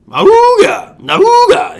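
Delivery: loud, high-pitched "awooga"; comic horn blast, shooting way up; mock alarm horn. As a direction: hoot like a klaxon going off.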